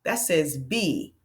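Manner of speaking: B said slow and drawn out; teacher modelling long E; long e sound. Shown as a demonstration. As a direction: stretch the B. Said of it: "listen to the sound".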